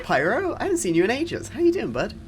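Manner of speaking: ordinary talk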